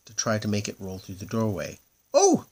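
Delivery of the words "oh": very high, loud squeaks; tiny panicked squeaks of a stuck moon; the stuck moon squeaking, very high and loud. Acted. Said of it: "squeaked the moon".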